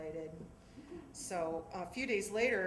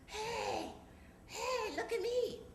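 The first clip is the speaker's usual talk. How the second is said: much higher mock holler from afar; distant hollering; slower than usual. Dad up in the rafters calling to the beach, high mock holler. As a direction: call out to be seen, hammed-up. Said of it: "I hear a man hollering".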